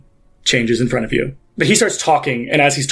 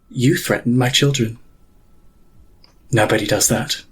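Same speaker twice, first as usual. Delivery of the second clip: cold furious Pontiff; 4 semitones lower than usual. Acted. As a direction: let her menace sit low and still. GM voices the angry Pontiff.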